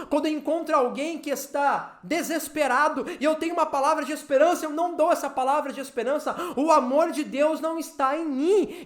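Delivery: driving, insistent sermon cadence; impassioned preaching. Real, not acted. Preacher challenges believers to live out love, impassioned preaching. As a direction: build link by link in a forceful exhortation.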